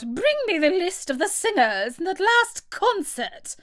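high-pitched royal command; high, demanding queen voice; imperious queen. A narrator's reading. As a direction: order it high, as a haughty queen. Queen orders an officer.

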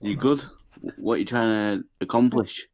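usual speaking voice

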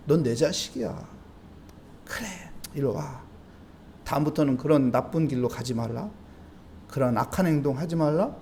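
put-on soft, low, gentle voice of a parent taking a child back; tender forgiving parent. Parent gently welcomes the sorry child back home.

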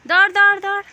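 calling out. Real, not acted. Aunt cheering little Kavya to run to her uncle, shouting.

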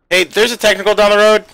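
urgent shout over gunfire; shouted callout. Real, not acted. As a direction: yell the warning loud. Combat callout under fire.

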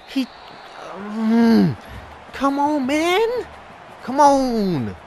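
high, loud pleading cry; anguished yell. Real, not acted. Gamer begging his players to get there, high anguished yell. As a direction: wail it at the screen.

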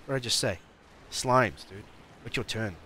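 playful telling-off at moderate volume; mock scolding. Player tells slimes to wait their turn, mock scolding. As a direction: remind them firmly, in play.

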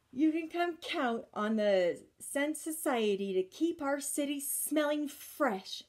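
high, proud civic-minded lady voice; proud society lady. Acted. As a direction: announce it like a proud club president. Miss Lily's parting promise.

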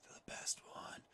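quick whispered side comment; whispered aside. Real, not acted. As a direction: whisper it like a secret tip. Whispered aside about cash dividends.

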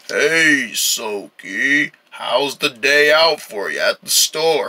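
low, easygoing greeting in a deep voice; dark voice. Acted. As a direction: greet them in your new low voice. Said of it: "There's that dark voice I was looking for".

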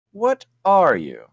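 slow, wary creature voice; lightly voiced wary Yeti; suspicious Yeti. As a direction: demand it slowly, suspicious.

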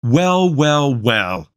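smug, drawn-out mocking greeting; arrogant voice. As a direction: sneer it like a school bully. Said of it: "he heard Zeke's arrogant voice".

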